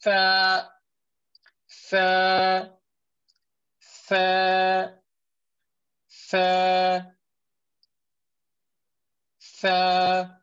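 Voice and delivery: 혀끝을 윗니에 댄 tha; tongue edge on the upper teeth, tha; demonstrated tha. Shown as a demonstration. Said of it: "So it's".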